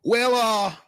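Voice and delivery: slow, sing-song hillbilly drawl. Pretend. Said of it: "do a redneck hillbilly voice".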